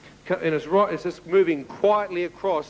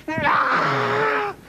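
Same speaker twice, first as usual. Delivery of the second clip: scream. Retold or imitated. Intruder caught in the torchlight screams in shock. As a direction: let out a sudden high shriek. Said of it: "and he screamed".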